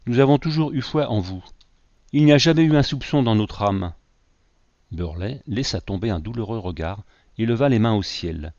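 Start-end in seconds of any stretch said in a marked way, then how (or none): 0.1-3.9 passionate avowal. A narrator's reading. Young woman passionately defending Burley.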